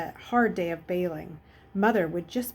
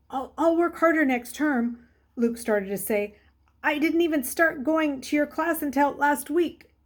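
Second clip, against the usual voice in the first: higher, louder anxious stammer; boy pleading with his teacher, nervously; 6 semitones higher, 4 dB louder than usual. A narrator's reading. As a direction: talk fast to get out of trouble.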